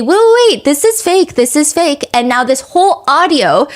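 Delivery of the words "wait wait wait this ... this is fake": high-pitched mock alarm, easing down; pitched-up, acted-out protest; imagined urgent objection. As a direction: imitate a sleuth jumping in to object. Voicing an internet sleuth's objection.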